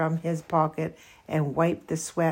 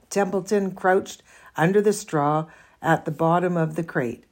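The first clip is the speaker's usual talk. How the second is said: plain reading voice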